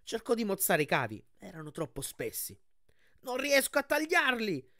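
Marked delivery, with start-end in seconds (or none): 3.2-4.7 high, drawn-out cry of alarm; urgent high-pitched shout; desperate shout. A narrator's reading. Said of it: "gridò".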